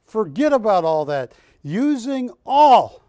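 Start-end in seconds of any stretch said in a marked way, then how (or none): 1.6-3.0 "Using all" slowed and punched out louder and higher; emphatic louder restart. Acted. Auctioneer hyping the crowd before Juliet, loud and slow. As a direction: hammer the words "Using all" slowly, like a showman.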